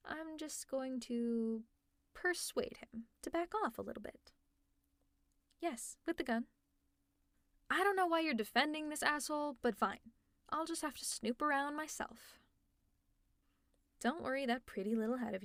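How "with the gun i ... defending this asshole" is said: ordinary delivery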